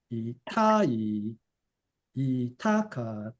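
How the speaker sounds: Itai and itakatta with the accent on the ta; pitch accent on ta. Shown as a demonstration. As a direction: lean on the ta in Itai and itakatta, drawn out. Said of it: "the ta gets the pitch accent".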